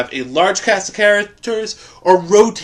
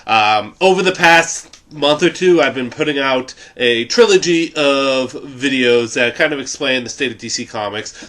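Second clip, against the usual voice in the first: normal talking voice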